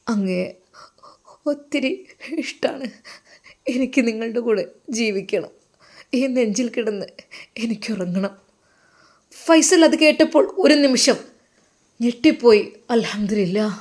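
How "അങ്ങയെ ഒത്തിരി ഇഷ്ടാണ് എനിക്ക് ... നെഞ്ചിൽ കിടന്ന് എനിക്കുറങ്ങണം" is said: soft, tender, hesitant; soft confession. Acted. Wife confessing love to husband, soft and tender. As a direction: tell him gently you love him.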